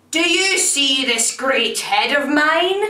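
a great looming thing, voice lifted high; fearsome monster. A narrator's reading. Fearsome thing taunts the tailor from the floor, monster voice.

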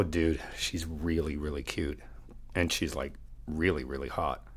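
hushed confiding. Acted. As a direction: lean in and confide it quietly. Confiding to friend about desk girl.

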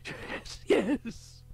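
a high, quivering cry of excitement; excited cry. A narrator's reading. Summers cries out, quivering with excitement. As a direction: breathe it out and then cry it high with thrill. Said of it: "Summers cried".

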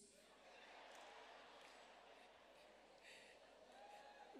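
long breathy wheezing laugh; soft, almost voiceless laughter; helpless quiet wheeze of laughter. Real, not acted. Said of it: "You know, I laugh".

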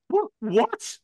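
high squeaky-pitched reaction quote. Retold or imitated. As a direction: voice jumps up high on a disbelieving question. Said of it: "My voice went really high then".

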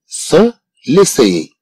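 slow model reading. Shown as a demonstration. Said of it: "teacher models slowly".

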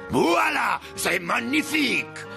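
high, drawn-out French flourish; triumphant unveiling. Acted. Painter reveals his cookie picture, triumphant flourish. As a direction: present it like a grand artiste.

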